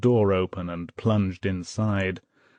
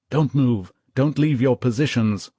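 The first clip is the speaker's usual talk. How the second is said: plain reading voice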